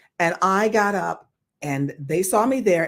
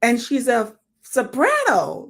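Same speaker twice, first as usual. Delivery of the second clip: higher, slow astonished praise; amazed panel; 6 semitones higher than usual. Retold or imitated. Casting panel amazed by her singing, higher and slow. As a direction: marvel at her voice. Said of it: "they were like".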